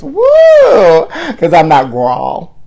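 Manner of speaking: put-on high whoop, then slow showy "that girl"; excited whoop. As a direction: belt it out like a diva. Whoops and sings a hook.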